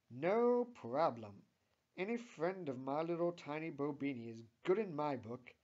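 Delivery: sing-out cheerful reassurance; happy, smiling. A narrator's reading. Linda accepts Tammy's thanks, happily. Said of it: "says Linda happily with a smile".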